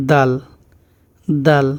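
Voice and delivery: দাল with a dental "d"; Bengali-style দ in দাল. Shown as a demonstration. Teacher models the Arabic letter, dental "d" in দাল. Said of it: "দ যেভাবে উচ্চারণ".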